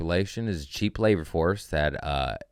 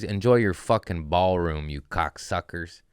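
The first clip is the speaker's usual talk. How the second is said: ordinary talk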